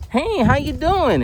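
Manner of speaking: high, slow, sugary salesbot voice; chirpy bot greeting. Retold or imitated. Mocking the automated email system, high and sugary. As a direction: sing it out like a fake-friendly robot.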